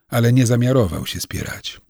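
ordinary delivery, nothing marked

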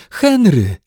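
high shouted call; krzyk. A narrator's reading. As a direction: shout for her husband in alarm. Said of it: "krzyczała pani Brown". (głośny okrzyk)

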